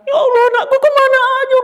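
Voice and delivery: very high, fast, tearful relief of a mother; weeping mother's relief. Acted. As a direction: sob out the joy of finding her child. Mother reunited, crying. (ibu menangis lega, suara sangat tinggi)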